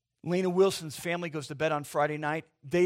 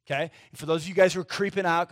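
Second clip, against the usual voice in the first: ordinary talk, nothing marked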